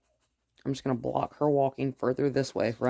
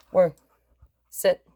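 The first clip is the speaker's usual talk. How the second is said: loud, sharp sit; louder one-word order; firm command; 5 semitones higher, 8 dB louder than usual. Real, not acted. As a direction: command the dog firmly. Command to dog.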